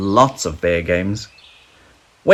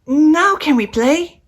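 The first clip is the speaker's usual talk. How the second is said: much higher, slow small cub voice; a tiny cub asking hopefully, slowly; little bear voice; 4 dB louder than usual. A narrator's reading. Little Bear's voice in story.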